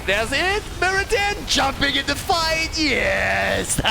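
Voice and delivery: high-pitched thrilled play-by-play; excited shout. Real, not acted. Caster calls Muradin jumping into the fight, excited shout. Live call of fight breaking out.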